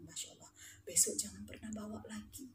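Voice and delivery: low-volume, stern telling-off; hushed warning. Retold or imitated. Teacher warning students in class, hushed warning. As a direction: warn them under the breath.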